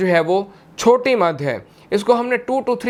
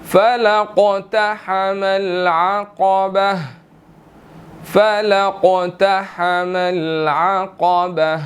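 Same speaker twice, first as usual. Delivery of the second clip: slow, drawn-out chanted recitation; measured tilawat before the lesson; Quranic recitation; slower than usual. Shown as a demonstration. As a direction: recite it slowly, stretching each vowel. Teacher recites the verse.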